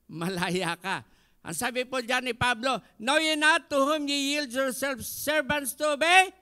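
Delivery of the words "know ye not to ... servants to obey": loud scripture read; preacher reading Paul's words; preaching. Acted. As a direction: proclaim the verse. Quoting scripture.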